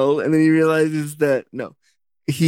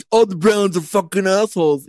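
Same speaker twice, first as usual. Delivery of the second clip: mild Scottish brogue, played for laughs; mock Scottish accent; 4 semitones higher than usual. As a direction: throw the line out in a jokey Scottish accent. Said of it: "I think that's Scottish".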